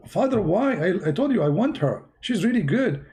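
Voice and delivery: high, protesting son; pleading son. Retold or imitated. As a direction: whine up at father, insisting. Son begging father again.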